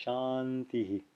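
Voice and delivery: softly said Shantihi, long first syllable, light hi; quiet, slow model of "Shantihi"; short soft echo. Shown as a demonstration. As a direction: let the final hi of Shantihi be a brief breathy echo. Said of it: "it's short and it's soft".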